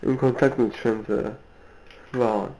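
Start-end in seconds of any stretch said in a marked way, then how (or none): none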